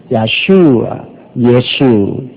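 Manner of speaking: 야슈아, 예수, 야슈 with the SHU long-drawn; drawn-out comparison. Shown as a demonstration. Preacher comparing Hebrew with Korean, names sounded out slowly. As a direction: stretch each name slowly to show the likeness. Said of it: "우리 한국말이 제일 맞다고".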